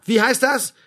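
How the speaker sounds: loud, high sergeant's question; barked demand. Acted. Sergeant corrects Ludwig's casual reply, barking. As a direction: insist on proper military address.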